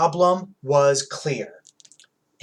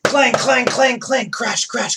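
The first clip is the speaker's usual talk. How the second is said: loud clanging; 11 semitones higher, 6 dB louder than usual. A narrator's reading. Loud noises in story.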